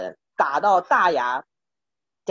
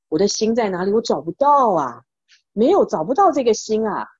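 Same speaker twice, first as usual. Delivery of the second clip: bewildered cry; 5 semitones higher, 5 dB louder than usual. Acted. Monk searching, bewildered.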